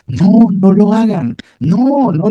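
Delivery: put-on slow, pleading child's voice, rising high; a frightened boy begging his friends to stop; scared little boy. Boy blocks the cake from the other kids, pleading and scared. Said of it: "y estaba asustado".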